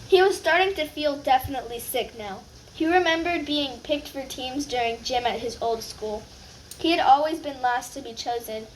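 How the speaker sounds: ordinary delivery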